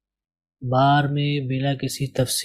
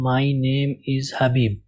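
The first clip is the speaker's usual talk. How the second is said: slow demo reading; much slower than usual. Shown as a demonstration. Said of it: "پہلے جملے کے بارے میں بات کروں".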